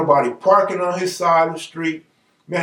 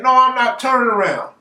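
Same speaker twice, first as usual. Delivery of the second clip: pitched-up mock defiance; indignant refusal; 7 semitones higher, 3 dB louder than usual. Man refusing the sheriff's order, indignant refusal. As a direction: refuse in a raised, higher voice.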